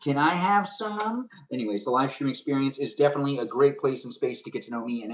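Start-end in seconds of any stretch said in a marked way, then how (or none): 0.0-1.3 put-on higher and slow, a kid's eager yes; eager little kid. A boy eagerly agreeing to help mama, high and slow. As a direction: draw out an excited child's answer. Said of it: "And I'd be like".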